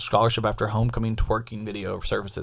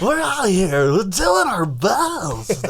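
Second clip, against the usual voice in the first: very slow, higher announcer drawl; Harry Carey voice; 6 semitones higher, much slower than usual. Pretend. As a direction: stretch it out like an old announcer. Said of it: "way more Harry Carey".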